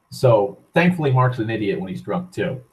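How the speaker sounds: ordinary talk, nothing marked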